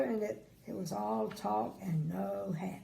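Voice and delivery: ordinary delivery, nothing marked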